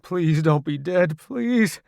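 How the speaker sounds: anxious begging, rising on the last word; desperate plea. A narrator's reading. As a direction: plead for a friend to be alive. Searching for comrade who may be dead.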